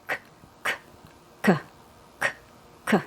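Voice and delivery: short voiceless k bursts; k sound demo. Shown as a demonstration. Teacher isolating the K sound in Alaska. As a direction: tap the k sound. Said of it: "You make your K sound".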